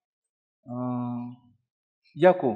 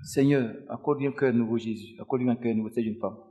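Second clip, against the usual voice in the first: quiet prayer; 5 semitones lower, 4 dB quieter than usual. Acted. Prays over the woman.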